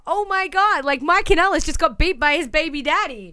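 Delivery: mock excited Renee. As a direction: blurt the gossip out. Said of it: "when Renee's like".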